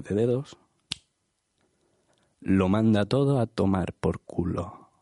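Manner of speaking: low, slow, grave voiceover; mock-serious show intro; solemn intro narration. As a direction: deliver it like a brooding film narrator.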